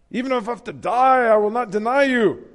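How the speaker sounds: loud, high vow; blind boast. Acted. Peter vowing to Jesus, loud and high. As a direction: swear it with fierce pride.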